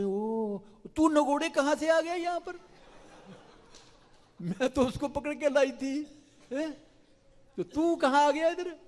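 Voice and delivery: very high, loud outraged squeal; bewildered fury at the wrong boy; shocked gopi. Acted. Gopi finds her own son instead of Krishna, high shocked outrage.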